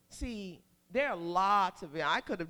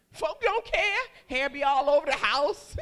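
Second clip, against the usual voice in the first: high, loud comic exclaiming; hammed-up, loud don't-care attitude; 5 semitones higher, 8 dB louder than usual. Preacher describing women in labor, comic exclaiming.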